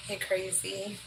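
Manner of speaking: ordinary talk, nothing marked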